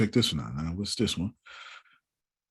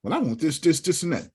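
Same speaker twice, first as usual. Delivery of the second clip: imitating Trinae; 8 semitones higher than usual. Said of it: "Trinae, how can we help you".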